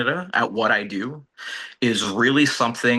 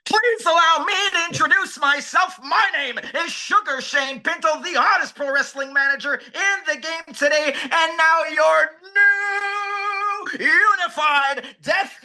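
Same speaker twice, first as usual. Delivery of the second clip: high, shouting hype promo voice; wrestling promo. Acted. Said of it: "I'm cutting a crazy promo".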